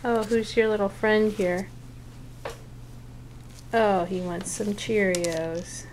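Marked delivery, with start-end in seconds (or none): none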